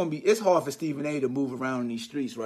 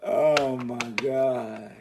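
amused drawl; 5 semitones lower, much slower than usual. Real, not acted. Amused after his own singing.

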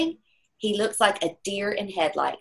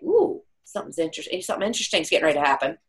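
knowing anticipation; much faster than usual. Retold or imitated. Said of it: "I'm like".

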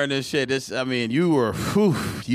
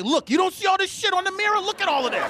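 high-pitched scolding imitation; nagging her partner to look; exasperated girlfriend; 12 semitones higher than usual. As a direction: play the fed-up girlfriend, voice pitched up. Girlfriend pointing out mirror mess, exasperated.

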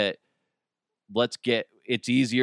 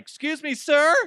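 mock ranger voice, pitched way up; high-pitched interruption; 4 dB louder than usual. Rangers interrupting a man on a picnic blanket, high mock call. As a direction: call out in a squeaky high register.

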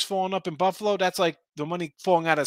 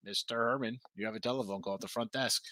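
quiet flat PA announcement; flat echoey pa page; 4 semitones lower, 9 dB quieter than usual. Retold or imitated. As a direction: read it like a hotel paging system. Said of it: "It's like".

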